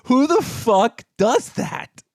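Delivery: high, slow, emphatic disbelief; incredulous outrage. Real, not acted. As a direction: draw out each word in exasperated outrage. Outraged at the cat story.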